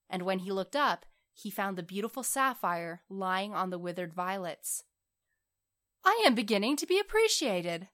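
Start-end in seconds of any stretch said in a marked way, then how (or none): 6.0-7.9 high, louder burst of excitement; delighted cry. A narrator's reading. Young writer finding the sapphire, crying out excitedly. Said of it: "he cried".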